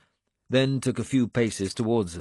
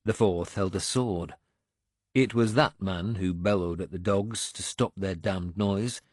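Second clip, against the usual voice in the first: ordinary delivery, nothing marked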